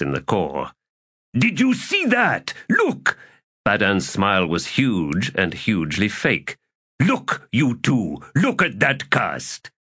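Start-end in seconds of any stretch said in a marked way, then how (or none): 1.4-3.2 very high, excited exclamation; excited crowing. Acted. A player shows off a perfect throw, excited. 7.0-9.7 high, slow, drawn-out urging; insistent excitement. Acted. A cheater draws eyes to the dice, excitedly insistent. Player urges others to look.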